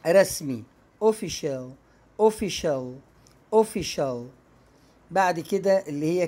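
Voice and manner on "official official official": official said o-fi-shul; teacher demo of official with sh; sh sound in official. Shown as a demonstration. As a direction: official with sh in the middle. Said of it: "هتنطق شين".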